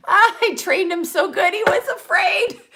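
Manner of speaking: high-pitched, laughing delivery. Real, not acted. Wife joking about how well she trained her husband, laughing. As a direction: squeal it out through laughter.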